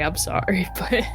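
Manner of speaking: ordinary delivery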